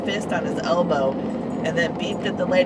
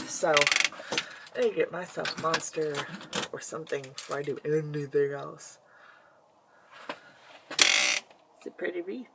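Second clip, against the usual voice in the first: normal talking voice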